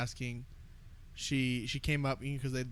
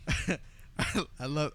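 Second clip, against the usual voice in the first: usual speaking voice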